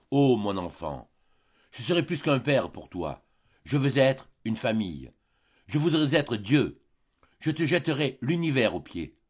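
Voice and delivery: loud, rising, almost feverish devotion; fervent passion. Acted. Old father embracing Eugène with feverish, loud devotion. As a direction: pour out a father's boundless love. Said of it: "avec une sorte de fureur".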